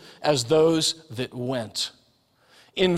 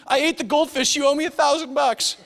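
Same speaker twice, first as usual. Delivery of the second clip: put-on much higher, triumphant little girl; thrilled child; 10 semitones higher than usual. Father imitates daughter announcing she ate the fish, high and thrilled. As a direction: chirp it out, beaming and proud. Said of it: "Maddie, what did you do".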